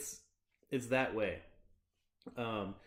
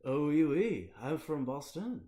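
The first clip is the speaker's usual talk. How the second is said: higher, louder "oui, oui" in a hammed-up French accent; mock French accent; 4 semitones higher, 4 dB louder than usual. Answering Ghosty's joke, hammed-up French accent. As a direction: play the Parisian Bostonian. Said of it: "the Parisian Bostonian".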